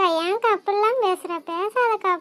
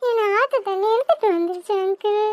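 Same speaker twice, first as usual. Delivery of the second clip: put-on high child voice, sniffling; frightened little girl; 3 semitones higher than usual. Girl explains fall, crying.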